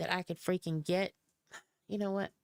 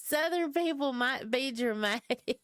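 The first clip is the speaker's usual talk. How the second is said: higher voice breaking into giggles; laughing; 6 semitones higher than usual. Real, not acted. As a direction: say it while cracking up, ending in laughter. Cracking up repeating the line.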